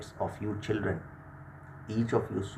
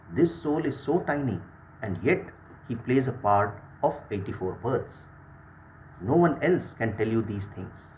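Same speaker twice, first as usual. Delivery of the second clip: usual speaking voice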